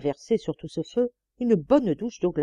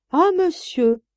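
higher, louder mock reproach; reproachful; 10 semitones higher, 7 dB louder, slower than usual. A narrator's reading. Maid scolds her master with feigned reproach. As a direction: scold him with feigned offence. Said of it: "sur un ton de reproche".

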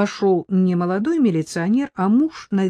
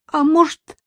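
plain reading voice

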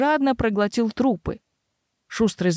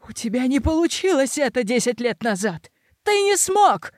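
shrieking rage; 4 semitones higher than usual. Acted. Roderick screaming in rage.

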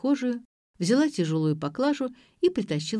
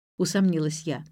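plain reading voice

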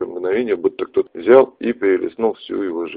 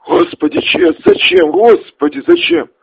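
high, anguished pleading; desperate, fearful cry; 6 dB louder than usual. A narrator's reading. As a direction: cry out in fear and despair. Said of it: "В его голосе слышался страх, отчаяние, боль".

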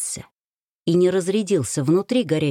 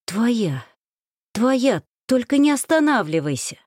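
higher, urgent plea with a touch of strain; voice worn from screaming; 5 semitones higher than usual. A narrator's reading. Wife begging her husband to continue, strained urgent plea. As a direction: plead urgently, pitch climbing. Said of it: "прохрипела сорванным от крика голосом".

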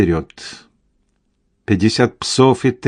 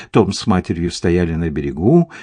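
ordinary delivery, nothing marked

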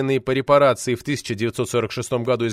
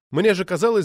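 usual speaking voice

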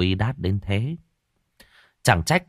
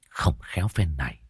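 despairing whisper; 4 semitones lower, 5 dB quieter than usual. A narrator's reading. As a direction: breathe it out, almost voiceless. Despairing man lowers his voice. (thì thầm tuyệt vọng)

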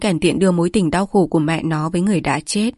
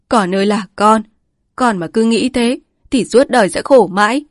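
higher and louder, a grieving scold; pleading old mother; 3 semitones higher, 4 dB louder than usual. A narrator's reading. Old mother pleading with her angry son, higher louder lament. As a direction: beg and scold him at once.

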